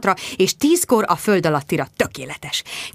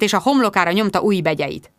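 ordinary delivery

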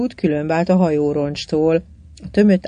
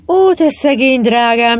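higher, louder lament with pity; sighing pity; 6 semitones higher, 6 dB louder than usual. A narrator's reading. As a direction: lament over a poor darling, voice lifted. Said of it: "sóhajtott Titti".

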